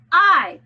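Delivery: I said loud and high; accented letter. Shown as a demonstration. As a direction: punch I. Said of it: "accent every other letter".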